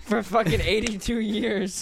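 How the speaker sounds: laughing. Real, not acted. Laughing over Alex's hiccups.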